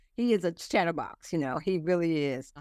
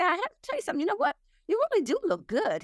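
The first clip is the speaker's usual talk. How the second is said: put-on much higher sweet child's voice; imitating grandson; 14 semitones higher, faster than usual. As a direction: pitch way up like a boy paying a compliment. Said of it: "he said".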